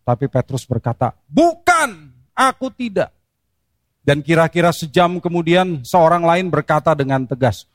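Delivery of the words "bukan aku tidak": much higher, sharp refusal; Peter's denial. Acted. Preacher voicing Peter's second denial, high and defensive. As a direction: cut him off, high and defensive.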